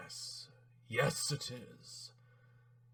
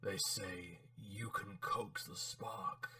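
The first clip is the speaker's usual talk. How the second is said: lowering voice; 9 dB quieter than usual. Acted. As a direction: sink gradually into a whisper. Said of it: "by gradually lowering your voice".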